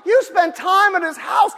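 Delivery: frustrated plea. Retold or imitated. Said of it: "I'm thinking".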